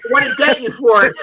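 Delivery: laughing teasing punchline. Retold or imitated.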